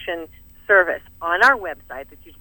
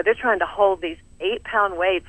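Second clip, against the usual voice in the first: ordinary delivery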